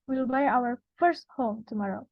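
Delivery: "first" stands out from the sentence; stress on FIRST. Shown as a demonstration. Presenter repeating the stress example, "first" emphasized. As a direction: put the main stress on "first". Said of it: "when we emphasize the word first".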